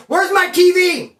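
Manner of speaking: high, loud, slow indignant yell; outraged demand. Retold or imitated. Inmate demanding his missing TV, loud indignant yell. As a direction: demand the TV back like it was stolen from you.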